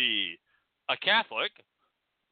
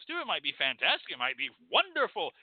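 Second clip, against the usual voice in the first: usual speaking voice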